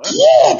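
high shriek kiee; shrill cry. Retold or imitated. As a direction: squeal like a cartoon creature. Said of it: "とか言わないもん".

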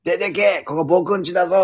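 put-on high indignant boy yelling. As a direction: shout it like a cornered kid.

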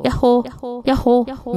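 called out with an echo; doubling echo effect. Shown as a demonstration. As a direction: yodel call with repeats. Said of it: "エコーじゃないけど".

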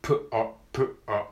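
プ、ア split into lip pop then R; P then R drill. Shown as a demonstration. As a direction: say プ and ア as two quick separate sounds. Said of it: "これを連続で".

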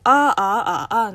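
あーあーあーあー sung through flat, rising, dipping and falling tones; four Mandarin tones. Shown as a demonstration. Host showing Mandarin's four tones, sung on one vowel. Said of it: "の姿勢があるので".

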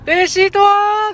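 drawn out like the strange shop clerk; co-host imitating the clerk's odd receipt question; odd clerk greeting. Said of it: "なんて言ってましたっけ？".

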